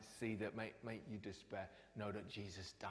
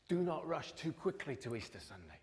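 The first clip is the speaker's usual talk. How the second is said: higher, louder urging; loud exhortation; 5 semitones higher, 5 dB louder than usual. Real, not acted. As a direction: call them to stay. Preacher exhorts loudly.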